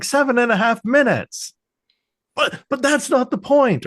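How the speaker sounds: very high exasperated outburst; hammed-up squawking protest; mock flustered boss. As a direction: imitate a boss undercut by his own bragging. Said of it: "bitching them out".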